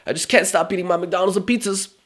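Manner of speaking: high, whiny mock voice of a lazy dieter; mock whiny complainer. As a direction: whine like someone who can't stop eating. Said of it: "Are you gonna say".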